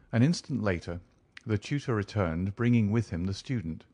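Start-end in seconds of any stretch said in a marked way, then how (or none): none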